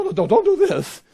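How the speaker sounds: high-pitched, hurried protest; imitated frantic objection; alarmed angel. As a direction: stop them in a mock panic. Angel stops John worshipping him.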